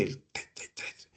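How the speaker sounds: treinta y tres mucho más suave, en secreto. Shown as a demonstration. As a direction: como paciente que susurra al médico. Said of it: "en secreto". (profesor imita a la paciente en secreto, voz muy suave)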